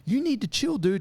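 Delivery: high, fed-up telling him to chill; exasperated warning. Retold or imitated. Arborist losing patience with the persistent attacker, high pitched. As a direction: tell him off, annoyed and high. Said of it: "She kept going like".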